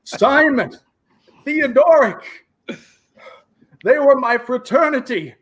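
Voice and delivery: high, accusing villain rant. Acted. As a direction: spit blame at the brute. GM voices halfling villain.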